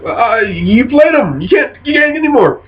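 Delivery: mildly exasperated, pitch climbing high; acted protest. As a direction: plead the rules with a rising voice.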